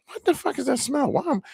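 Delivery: high, appalled at the stink; disgusted. Retold or imitated. Skater smelling his own shirt, appalled high. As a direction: recoil from a bad smell.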